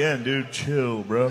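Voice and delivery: quiet. Pretend. First, quieter take.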